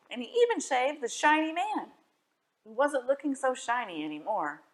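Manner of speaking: plain reading voice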